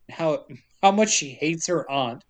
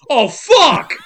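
high, loud panicked cry; panicked yelp; 9 semitones higher, 9 dB louder than usual. Retold or imitated. Demon inside sees the hypnosis crystal, high loud panicked yelp. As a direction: yelp it in sudden dread.

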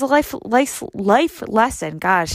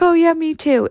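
mock-eager agreement, pitched up high; imitating an over-keen sympathiser; eager commiseration; 8 semitones higher, 4 dB louder than usual. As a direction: chime in higher as a fellow complainer. Mimics people sharing complaints.

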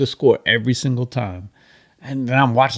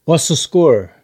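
put-on pushy teen voice; 5 semitones higher, 6 dB louder, slower than usual. Annoying teenager voiced in story.

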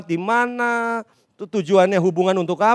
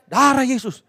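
higher-pitched mock exclamation; mimicked pious exclamation; 4 semitones higher, 3 dB louder than usual. Preacher mimics friend riding in his car, startled exclamation. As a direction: blurt it like a startled pious passenger.

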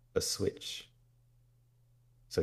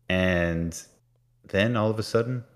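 ordinary talk, nothing marked

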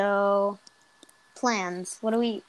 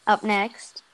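ordinary talk

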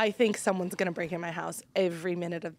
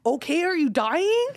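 slow, high-pitched sarcastic question; mock exasperated retort; 7 semitones higher, 4 dB louder than usual. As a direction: act out an unimpressed wife's retort, drawn out. Wife retells her retort to husband.

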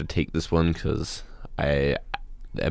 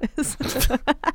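turkey gobble. Acted. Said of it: "That was way better than I could do".

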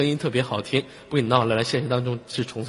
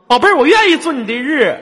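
hammed-up flirt; 11 semitones higher, 9 dB louder than usual. Playful flirty exaggeration.

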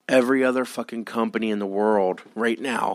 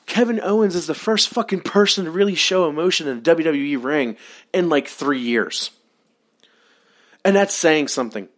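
ranting; 7 semitones higher, 4 dB louder than usual. Real, not acted. Ranting about WWE promos.

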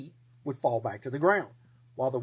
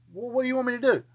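higher, exasperated question; 9 semitones higher, faster than usual. Acted. Gideon asking God, higher and exasperated. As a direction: throw up your hands.

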